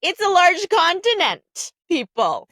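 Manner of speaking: high-pitched, exasperated correction; exasperated retort. Retold or imitated. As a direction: retell it as a pointed, exasperated comeback.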